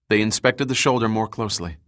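usual speaking voice

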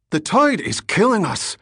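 high, loud, panicked Irish rebel; frightened shout. Acted. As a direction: shout back in fear over the noise. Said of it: "the frightened Irish rebel shouted back".

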